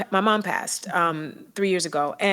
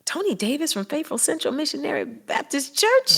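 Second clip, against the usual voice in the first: astonished question; 7 semitones higher than usual. Retold or imitated. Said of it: "I was like".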